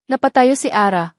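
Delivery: ordinary delivery, nothing marked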